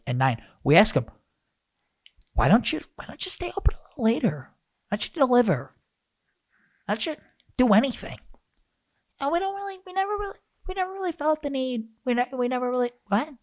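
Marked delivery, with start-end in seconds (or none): 2.3-8.2 mocking questions. Mock questions to pizza shop. 9.2-13.3 much higher, lazy dopey owner; mock the clueless owner; dopey shop owner. Mocking pizza owner's answer.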